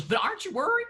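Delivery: high, sing-song Southern mom worrying; Southern accent. Retold or imitated. Imitating his worried Southern mom. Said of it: "She has a Southern accent".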